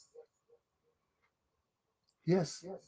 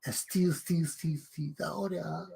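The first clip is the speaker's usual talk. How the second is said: mocking fade-out; 2 semitones lower, 12 dB quieter than usual. Real, not acted. Mocking repeated steal, fading.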